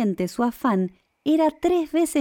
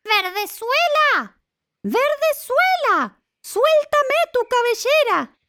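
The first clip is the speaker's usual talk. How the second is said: very high, drawn-out call; long, singsong high-pitched holler; witch calling up; slower than usual. A narrator's reading. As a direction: call up to a tower window. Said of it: "se colocaba al pie y gritaba".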